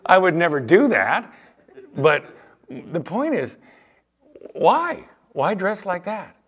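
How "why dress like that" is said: ordinary talk, nothing marked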